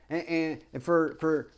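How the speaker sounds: usual speaking voice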